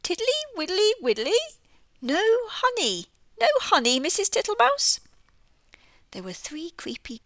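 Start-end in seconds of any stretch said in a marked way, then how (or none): 0.0-5.0 high, loud questioning toad. Acted. Mister Jackson the toad searching the pantry for honey. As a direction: sniff about for honey, puzzled.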